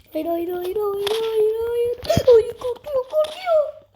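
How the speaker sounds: mock panic. Mock panic as opponent cowers in game.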